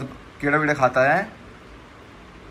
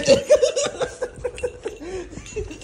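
high real laugh. Real, not acted. Dog snatches food. (ज़ोर की ऊँची हँसी)

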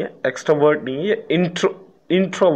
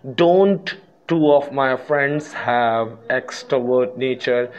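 slow example reading; much slower than usual. Shown as a demonstration. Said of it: "reads negative question".

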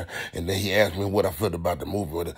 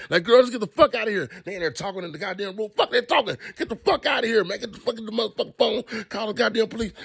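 higher, exasperated orders; scolding the screen; 12 semitones higher than usual. Real, not acted. Telling the people in the movie what to do, outraged. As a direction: boss the movie characters around.

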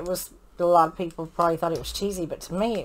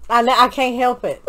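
ordinary talk, nothing marked

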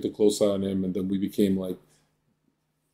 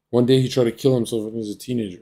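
ordinary talk

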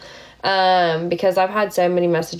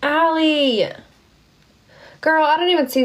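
higher, delighted shout of a name; excited call; 7 semitones higher than usual. Real, not acted. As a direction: call out happily to a gifter. Excited greeting of a gifter.

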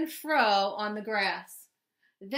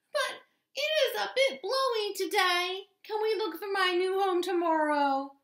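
high small creature voice; little mole voice; 9 semitones higher than usual. A narrator's reading. Mole asks hedgehog to wait till tomorrow, high voice.

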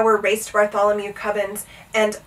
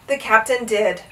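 plain reading voice

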